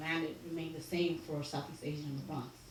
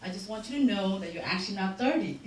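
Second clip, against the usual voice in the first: retelling dad's news while laughing, louder and higher; laughing dad quote; 5 semitones higher, 8 dB louder than usual. Retold or imitated. Daughter retells dad's thirtieth birthday surprise, laughing. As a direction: crack up through his announcement. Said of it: "And he's like".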